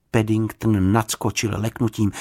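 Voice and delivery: plain reading voice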